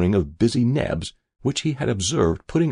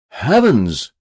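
cried out; 3 semitones higher, 7 dB louder than usual. A narrator's reading. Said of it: "cried Mister Castwell".

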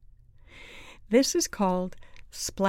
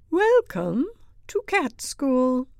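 high, loud teacher greeting; slow, high-pitched schoolteacher welcome; welcoming teacher; 7 semitones higher, 7 dB louder, slower than usual. A narrator's reading. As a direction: greet a new class grandly. Big round teacher greets new pupil.

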